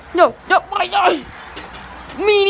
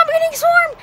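high, loud panicked yell; panicked high cry; 10 semitones higher, 3 dB louder than usual. Pretend. Kid playing a bee-swarmed character, yelling in mock panic. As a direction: shriek it like bees are everywhere.

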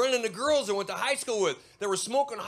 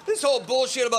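ordinary talk

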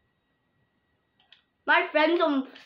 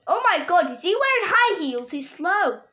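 high loud alarmed rush; being chased; 6 semitones higher, 9 dB louder than usual. Real, not acted. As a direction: blurt it out in alarm while fleeing. Chased in game.

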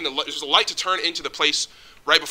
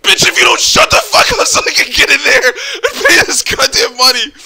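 high, loud, laughing mock-furious rant; 10 semitones higher, 9 dB louder than usual. Raging at the old lady blocking the office, loud laughing rant. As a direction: explode at her, half laughing. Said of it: "I'm like".

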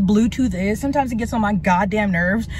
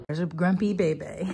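normal talking voice

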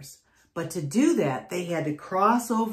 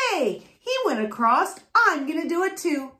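middle goat voice; 9 semitones higher than usual. A narrator's reading. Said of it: "the middle Billy Goat Gruff said".

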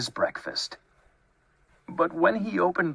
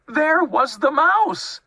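high, loud, quicker outburst; exasperated reveal; 14 semitones higher, 5 dB louder than usual. A narrator's reading. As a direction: announce the discovery at high pitch.

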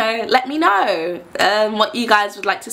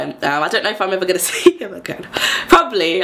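ordinary talk

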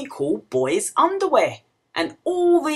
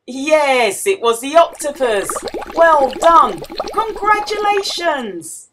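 bright, louder praise; cheering; 5 semitones higher, 7 dB louder than usual. Acted. Teacher congratulates children on the right answer, bright. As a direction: praise the children warmly and loud.

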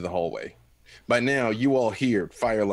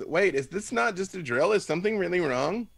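higher, worried ditzy voice; higher, anxious, naive; confused young woman; 8 semitones higher, 4 dB louder than usual. Acted. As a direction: ask in alarm. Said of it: "she goes".

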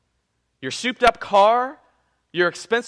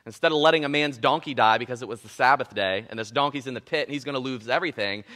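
normal talking voice

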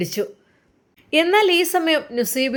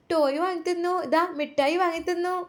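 put-on higher, chirpy child voice listing her presents; little girl; 7 semitones higher than usual. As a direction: proudly show off the toys like a small girl.

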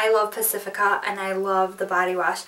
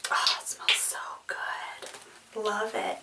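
soft whisper, savouring the scent; hushed, pleased whisper; whispered delight; 10 dB quieter, slower than usual. Real, not acted. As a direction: breathe it out as you smell it. Smelling the product, hushed.

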